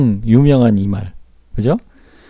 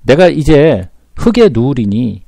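slow emphatic repeat; slower than usual. Shown as a demonstration. Preacher driving home Job's death wish, slowed and emphatic. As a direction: drag out "내가 이제 흙에 누우리니", pressing on 흙에.

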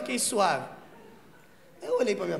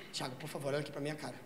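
hushed earnest plea; 7 semitones lower, 11 dB quieter, faster than usual. Retold or imitated. Woman pleads seriously.

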